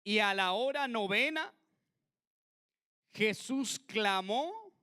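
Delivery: slow, solemn reading of the passage; scripture reading. Acted. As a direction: read the verse with weight. Lee el pasaje en voz alta. (lectura solemne y lenta)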